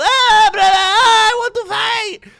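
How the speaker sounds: very high, loud cry of brother; Chimaev impression. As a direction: yelp it like a hungry fighter. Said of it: "That's how Chimaev talks".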